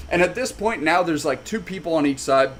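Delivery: ordinary delivery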